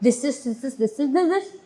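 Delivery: soft stuttering repeat, stuck on one word; mock nervous stutter; nervous stammer. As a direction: stammer it out like a blanked-out candidate. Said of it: "मैंने जैसे याद किया था वैसे ही बोल दिया".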